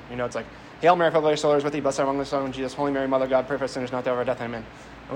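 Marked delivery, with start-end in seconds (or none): 0.8-4.7 fast auctioneer-style prayer rush; rattled off prayer. Retold or imitated. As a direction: zip through it like an auctioneer. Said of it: "we sound like a tobacco auctioneer".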